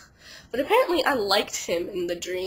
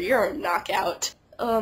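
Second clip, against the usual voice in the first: ordinary delivery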